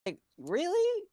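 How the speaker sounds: high, slow, disbelieving; incredulous. Real, not acted. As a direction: stretch it out in disbelief. Incredulous reply.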